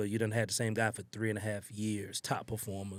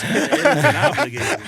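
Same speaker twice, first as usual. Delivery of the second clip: much higher and louder, mock drill-sergeant bellow; hammed-up shout; 14 dB louder than usual. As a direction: belt it out like a joking command. Repeating the gym rule, hamming.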